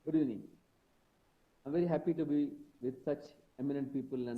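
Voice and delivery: faint, distant mic; speaking too far from the mic; barely audible. Real, not acted. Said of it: "very, very low".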